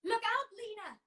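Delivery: very high, loud shout of alarm; urgent warning shout. Acted. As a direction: yell to save her from the table. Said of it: "came a shout from behind her".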